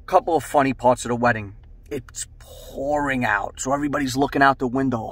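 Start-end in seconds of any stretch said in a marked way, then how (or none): none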